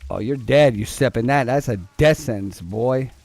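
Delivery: ordinary talk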